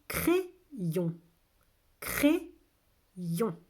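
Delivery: two well-separated syllables. Shown as a demonstration. Teacher repeats the word for listening children, syllable by syllable. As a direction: sound it out with a gap between beats.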